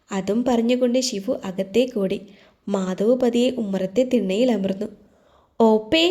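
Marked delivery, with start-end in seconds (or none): 5.6-6.0 calling out across the house; loud call. A narrator's reading. Girl running into the kitchen, calling her sister loudly. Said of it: "ഉറക്കെ വിളിച്ചു".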